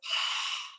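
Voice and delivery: soft breathy ocean sound through the throat; audible exhale demo, throat slightly closed; ujjayi breath demo. Shown as a demonstration. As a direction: fog a pair of glasses with your breath. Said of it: "it sounds like this".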